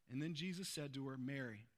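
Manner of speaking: soft, low reading; hushed, tender. A narrator's reading. Tender moment in scripture reading.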